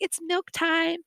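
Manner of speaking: high-pitched classroom call; lilting high teacher voice; teacher's announcement. Acted.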